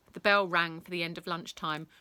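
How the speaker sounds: ordinary delivery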